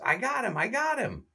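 very high, excited squeal; triumphant Curly. Retold or imitated. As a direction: crow it like a proud little boy. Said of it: "and says".

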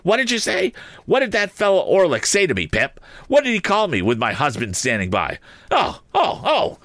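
high, shrieking outrage; screaming. A narrator's reading. Sister screams at Pip over Orlick's insult, shrieking high. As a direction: shriek the words, beside herself. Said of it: "beginning to scream".

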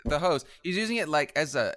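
ordinary talk

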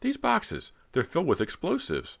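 put-on high-pitched, startled young heroine; shocked surprise. A narrator's reading. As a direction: blurt out an alarming discovery, pitch way up. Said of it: "she cried, unable to hide her surprise".

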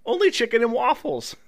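normal talking voice

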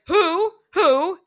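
high, loud repeated who; mock demanding. Teacher demanding an answer from a mom, loud mock who. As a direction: bark the question twice, hammed-up. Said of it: "And Abby's like".

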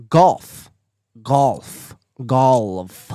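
loud, emphatic "Golf", each one separate; sounding the L. Shown as a demonstration. Proving the L to chat, loud deliberate "Golf". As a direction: stress the L in "Golf", drawing out the last. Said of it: "am I not saying the L?".

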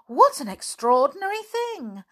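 high gasp, then exclaim; astonished gasp. Acted. Flip astonished that Sammy never sent the doctor. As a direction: gasp in disbelief.

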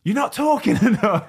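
high-pitched mock shout with a laugh breaking in; high, hammed-up touchline yell; retold coach's yell. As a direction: imitate a coach yelling across a pitch. Acts coach yelling during the session.

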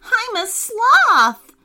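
high, slow dismayed cry; a high, loud, slow cry of shock; shocked realisation. Acted. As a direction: drag it out in disbelief. Nico realises he's a sloth.

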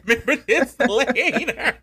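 slow, laughing repeat of the line; echoing her friend through real laughter. Real, not acted. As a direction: laugh it back at her slowly.

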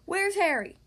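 high, loud demand; yelling. A narrator's reading. Both monsters hunt their rival at the party, yelling. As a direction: call out angrily over the party. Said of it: "both monsters yelled".

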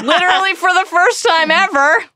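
high laughing sarcasm. Real, not acted. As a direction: laugh through the joke.

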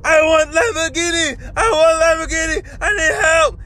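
high-pitched imitation of a screaming man; mock scream. Podcaster imitating the attacker being tackled, mock scream. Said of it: "this guy's screaming".

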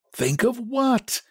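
slow, high, bewildered question. A narrator's reading. Husband does not follow his frantic wife, questioning. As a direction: draw out the puzzled question. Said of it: "he questioned".

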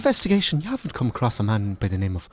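every line tips up like a question; James Cromwell's Irish accent. Retold or imitated. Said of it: "everything's a question".

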